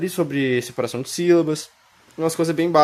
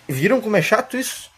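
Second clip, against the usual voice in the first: exasperated; 9 semitones higher than usual. Real, not acted. Teacher complaining about rule.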